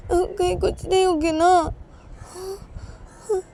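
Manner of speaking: put-on high, tearful, panicky girl's voice; scared and teary, voice climbing; frightened whimper. A narrator's reading. Young wife alone in the washroom, frightened and tearful. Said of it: "तो तभी उमा डर जाती है".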